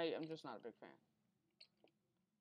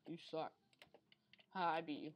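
ordinary delivery

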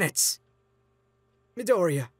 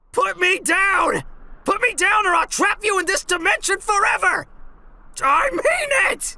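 furious screaming; 9 semitones higher, 6 dB louder than usual. Acted. Irwin struggling, screaming to be put down.

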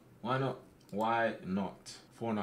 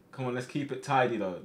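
ordinary talk

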